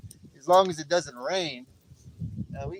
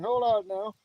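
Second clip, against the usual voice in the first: usual speaking voice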